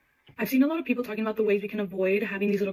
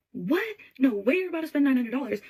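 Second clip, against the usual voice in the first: freaked out; 4 semitones higher than usual. Retold or imitated. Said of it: "The mom literally freaked out".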